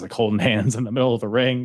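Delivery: usual speaking voice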